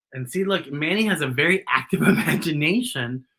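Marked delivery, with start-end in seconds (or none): none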